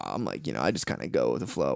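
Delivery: very low, soft mellow voice; laid-back pushover. Retold or imitated. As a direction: imitate an easygoing type, dropped deep and quiet. Imitates a laid-back acceptance freak.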